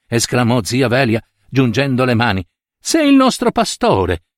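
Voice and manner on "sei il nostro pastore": much higher, hands clasped in resignation; pleading old aunt. A narrator's reading. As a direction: plead helplessly like a devout aunt. Aunt wrings hands, defending obedience.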